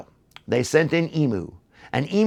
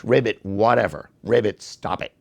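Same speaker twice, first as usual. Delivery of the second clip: ordinary delivery, nothing marked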